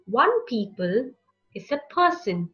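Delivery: plain reading voice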